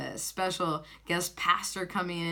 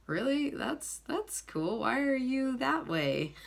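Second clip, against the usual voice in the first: higher, hesitant, curious questioning; retold puzzled surprise; 8 semitones higher, slower than usual. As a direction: imitate a taken-aback clerk, pitched high. Retold clerk reacting in surprise.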